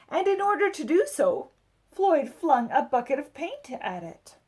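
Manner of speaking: expressive read-aloud storytelling; picture book narration. A narrator's reading. Adult reading a picture book to children, lively storybook voice. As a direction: tell it like a bedtime story for kids.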